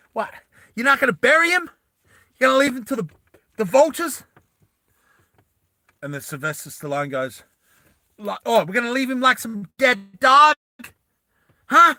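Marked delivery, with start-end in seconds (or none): 0.1-4.2 high, loud, incredulous protest; Vas outraged. Acted. Vas objects to leaving his comrade unburied, outraged and high. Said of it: "And Vas is like". 8.4-11.9 high, loud challenge, hitting the last words; angry protest. Acted. Vas challenges Stallone over abandoning the body, loud outrage.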